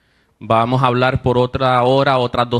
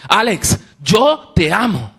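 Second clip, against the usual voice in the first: firm, slow "Alex, yo te amo", higher and weighty; strong voice; 5 semitones higher, much slower than usual. Retold or imitated. Lady turns serious and declares love to Alex, firm and slow. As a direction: say it slowly with serious conviction. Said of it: "con una voz fuerte me dice".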